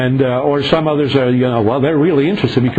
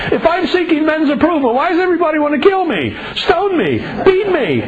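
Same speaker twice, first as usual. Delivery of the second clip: Paul's retort; 10 semitones higher than usual. Retold or imitated. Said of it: "like Paul said".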